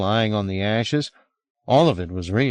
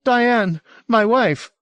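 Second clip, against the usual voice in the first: high, louder, indignant answer; agitated husband, accusing; deepening suspicion; 14 semitones higher, 5 dB louder, much slower than usual. A narrator's reading. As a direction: throw it back high and loud. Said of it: "His suspicion was plainly deepening into certainty".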